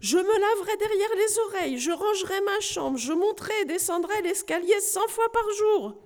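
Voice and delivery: high, loud pleading list; shouted promises. Acted. Knight shouting promises up to the parrot, loud and high. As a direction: cry out every promise. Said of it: "continua à crier messire Grognon".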